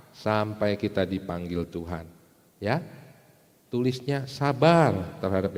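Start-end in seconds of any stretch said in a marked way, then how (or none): none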